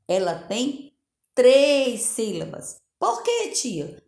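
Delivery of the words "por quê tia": put-on high child's voice asking the teacher; pupil asking. Teacher plays a pupil asking her, high child voice. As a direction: play a curious little kid.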